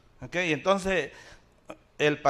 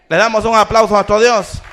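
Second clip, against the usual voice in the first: loud, raised-pitch call for applause; rallying call; 4 semitones higher, 11 dB louder than usual. Real, not acted. Preacher asking the church to applaud God, loud rallying call. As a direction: call out to the whole congregation.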